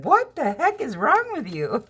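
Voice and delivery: high, slow mock-baffled sister voice; incredulous sister. Sister reacting to the pun, high baffled mock voice. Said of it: "My sister's looking at me like".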